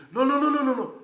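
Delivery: high, loud string of no's; vehement denial. Retold or imitated. Rejoue son refus du colis. (refus insistant, aigu et fort)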